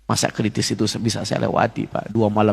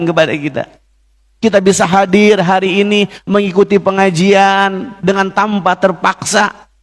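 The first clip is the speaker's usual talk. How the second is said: higher, louder, building sermon voice; rousing preaching; 6 semitones higher, 4 dB louder than usual. Acted. Preacher urging the congregation to worship gladly, rousing sermon. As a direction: preach it out with rising fervour to the hall.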